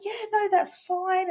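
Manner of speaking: high, slow, sugary mock-innocent voice; mock sweetness. Aggressive email clients turning meek on the call, mock sugary voice. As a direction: mimic a suddenly agreeable client, all innocence. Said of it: "they're literally like".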